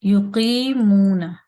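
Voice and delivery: "युकीमूना" loud and slow, qee and moo held; loud recitation example. Shown as a demonstration. Teacher reciting the written example, loud with long vowels. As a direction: project "युकीमूना", stretching the middle vowels. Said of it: "हम पढ़ते हैं".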